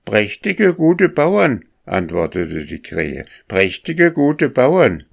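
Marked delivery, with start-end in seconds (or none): 0.0-1.6 very high crow voice; high-pitched bird's cry from below; cawing crow. A narrator's reading. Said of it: "hörte er unten im Nebel eine Krähe krächzen". 3.5-5.0 very high crow voice; high-pitched bird's cry from below; cawing crow. A narrator's reading. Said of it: "eine Krähe krächzen".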